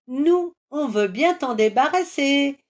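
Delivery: high, loud greedy cry; eager trolls. A narrator's reading. Trolls eagerly offer to take the eggs, greedy shout. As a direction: grab at the offer loudly. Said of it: "s'écrient les trolls".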